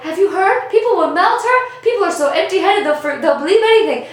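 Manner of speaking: Fiero voice. Retold or imitated. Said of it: "Fiero's like".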